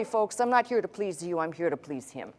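usual speaking voice

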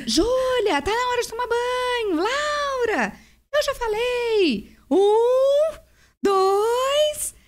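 high-pitched mock yelling at the daughters; a hammed-up impatient father counting down; husband yelling from the bedroom at the girls; imitating dad yelling. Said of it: "ele ficava gritando".

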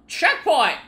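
high, loud triumphant yell; excited shout. Real, not acted. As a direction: cheer as you reach it. Reaching checkpoint in game.

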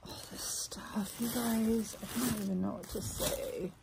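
hushed, overwhelmed near-whisper; emotional, near tears. Real, not acted. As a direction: barely get it out, moved to tears. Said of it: "such an emotional wreck".

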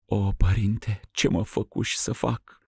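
soft, high, weeping question; tearful lament. Acted. Ruxanda weeping to the metropolitan. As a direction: plead through tears. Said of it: "plângând".

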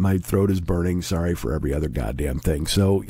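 ordinary talk

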